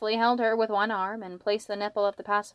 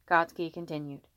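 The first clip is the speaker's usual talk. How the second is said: usual speaking voice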